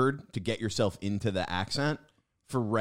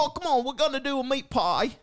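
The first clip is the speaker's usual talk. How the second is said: high, bad British chef accent; 13 semitones higher than usual. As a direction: play a cheery British cook. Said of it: "that was your British?".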